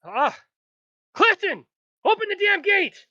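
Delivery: put-on old guard bellowing at Clifton to open the gate; barking order. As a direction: yell it down at your underling. Old guard yells at junior.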